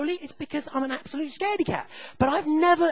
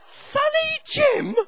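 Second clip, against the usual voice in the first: very high, drawn-out disbelieving question; mock-baffled high repeat; incredulous echo; 5 dB louder, much slower than usual. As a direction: squeak it back in disbelief. Incredulous echo of his own words.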